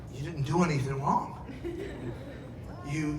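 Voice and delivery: ordinary talk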